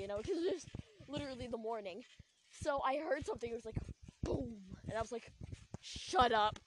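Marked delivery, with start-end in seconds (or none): 5.8-6.6 louder, curt passive-aggressive shut up. Retold or imitated. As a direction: cut her off coldly, a touch louder. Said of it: "so passive aggressive".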